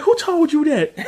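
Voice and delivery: high-pitched incredulous demand; mock indignant surprise. Uncle caught out about the store, mock indignant. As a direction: squeal the question like someone caught out. Retelling Bernie challenged about an accusation.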